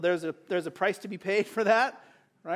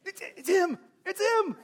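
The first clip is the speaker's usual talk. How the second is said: pitched way up, eager; excited recognition; 10 semitones higher than usual. Retold or imitated. Listener reacting to Mary missing Jesus, excited high blurt. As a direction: blurt it out high like you just spotted him. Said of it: "you're like".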